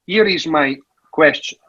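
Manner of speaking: normal talking voice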